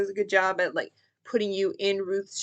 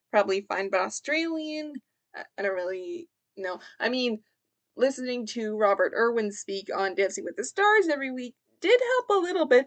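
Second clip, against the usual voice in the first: ordinary delivery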